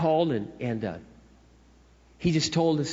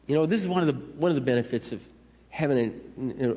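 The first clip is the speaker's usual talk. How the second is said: normal talking voice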